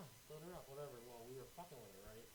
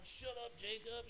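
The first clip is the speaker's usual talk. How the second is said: mock retort; 8 semitones higher, slower than usual. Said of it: "And I'm like".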